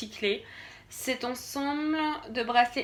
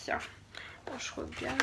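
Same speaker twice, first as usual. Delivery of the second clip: breathy whisper while checking; hushed self-talk while reading the card; whispered to herself; 2 semitones higher, 8 dB quieter, slower than usual. Real, not acted. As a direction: whisper it as if only to yourself.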